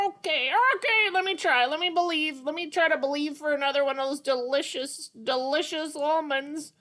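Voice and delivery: high, bright puppet character, eager; Petrie puppet voice. Acted. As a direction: play the little pterodactyl, keen to try.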